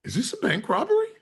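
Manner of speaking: high, fast, stunned question; shocked question. Retold or imitated. Customer grasping a bank robbery, high fast and stunned. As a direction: blurt it out in total shock.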